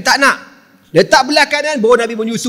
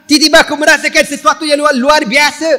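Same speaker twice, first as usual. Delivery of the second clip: high, louder rising amazement; excited wonder; 7 semitones higher, 5 dB louder than usual. Retold or imitated. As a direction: build up the miracle. Halimah excited at miracle.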